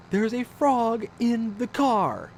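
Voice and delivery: high, slow, insistent delivery; mock-grave, drawn-out alarm; worked-up wife. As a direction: spell out the emergency word by word. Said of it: "I'm making her sound like she's, like, this crazy person".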